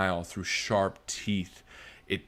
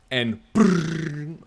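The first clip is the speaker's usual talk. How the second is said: rolled brrr of an arrow landing; a buzzing thunk sound; arrow thud; 7 semitones higher, 6 dB louder than usual. A narrator's reading. Narrating arrow thudding into tree.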